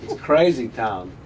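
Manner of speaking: deadpan Walken cadence; Christopher Walken impression. Continues Walken bit.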